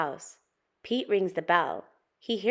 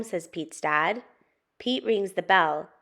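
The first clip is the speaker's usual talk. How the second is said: usual speaking voice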